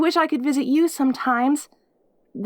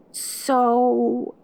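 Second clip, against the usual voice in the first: normal talking voice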